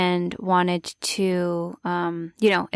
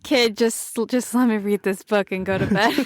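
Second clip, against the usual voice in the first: quick, lightly voiced fed-up parent; playfully imitating an impatient mother; mock dismissive mom; 2 semitones higher, faster than usual. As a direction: brush the kid off in jest. Listener jokingly voices the weary mom.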